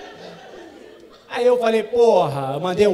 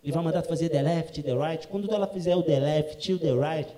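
ordinary talk, nothing marked

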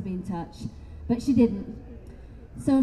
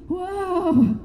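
stunned whoa; 8 semitones higher, 3 dB louder than usual. Retold or imitated. Reacting to the spirits.

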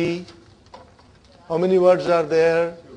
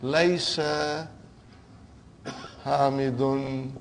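stretched syllables, long gaps between words; slow dictation; 3 semitones lower, 5 dB quieter, slower than usual. Shown as a demonstration. Teacher writing out the changed sentence for students, slow dictation. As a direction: dictate slowly and evenly, a little low. Writing second example while saying it.

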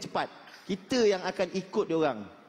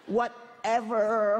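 long drawn-out, higher whatever; trendy whatever; 5 semitones higher, much slower than usual. Retold or imitated. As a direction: stretch it out like a trendy city kid. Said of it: "sekarang trend lah tu".

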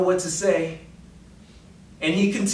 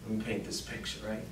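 whispering; 7 semitones lower, 12 dB quieter, faster than usual. Real, not acted. Storyteller leaning in.

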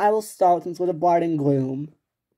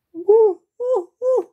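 high, loud monkey hooting; monkey hoots; 4 dB louder, slower than usual. Acted. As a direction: hoot like a monkey. Acting out a monkey in charades.

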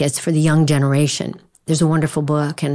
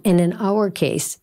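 usual speaking voice

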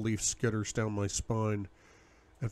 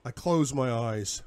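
ordinary delivery, nothing marked

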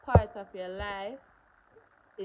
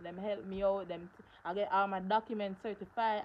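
ordinary delivery

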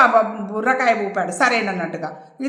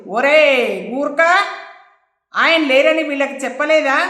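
calls out louder to the gurkha; sharp raised call across the yard; shouting; 3 semitones higher, 4 dB louder than usual. A narrator's reading. Minister's wife shouting at the gurkha, raised voice. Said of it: "అరిచింది".

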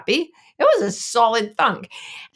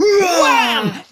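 sound effect; 11 semitones higher, 9 dB louder than usual. Retold or imitated. Blow in the retelling.

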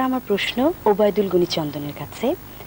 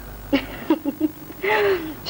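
a bright high burst of laughter; 8 semitones higher, 4 dB quieter than usual. Real, not acted. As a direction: laugh high and delighted at the joke. Host laughs at the rhyme.

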